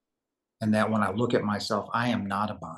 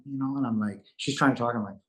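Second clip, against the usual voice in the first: usual speaking voice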